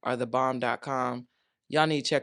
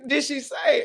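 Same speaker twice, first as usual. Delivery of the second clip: sassy hype; 10 semitones higher than usual. Acted. Repeating the hype call.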